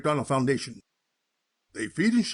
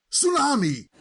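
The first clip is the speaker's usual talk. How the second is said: mock yell; 6 semitones higher than usual. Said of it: "everyone ran out yelling".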